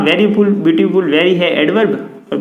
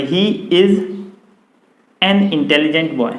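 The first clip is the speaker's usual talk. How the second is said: slow dictation read; much slower than usual. Shown as a demonstration. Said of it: "teacher reads example sentence".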